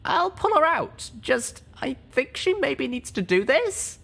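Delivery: put-on a young mare thinking aloud, slowly; hesitant young mare. Littlepip voice, slow.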